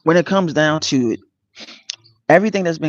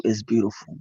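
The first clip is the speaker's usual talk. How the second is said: "it's beautiful" said softer, hushed and moved; hushed emphasis; 4 semitones lower, 9 dB quieter than usual. Real, not acted. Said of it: "When I say it's beautiful".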